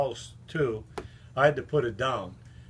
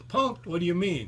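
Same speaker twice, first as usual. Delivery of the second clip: much higher, baffled disbelief; shocked; 6 semitones higher than usual. Retold or imitated. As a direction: yelp it, caught off guard. David shocked to hear the truth.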